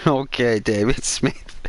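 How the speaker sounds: ordinary talk, nothing marked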